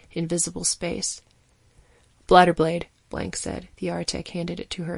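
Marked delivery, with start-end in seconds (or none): 2.3-2.9 sharp loud call for the tool; curt call. A narrator's reading. Surgeon calling for the blade. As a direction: snap it out across the table.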